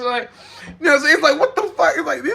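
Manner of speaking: high, laughing through the words. Real, not acted. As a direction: crack up at how absurd it is. Laughing at copied ideas.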